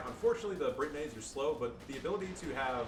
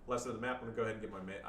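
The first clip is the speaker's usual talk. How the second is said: ordinary talk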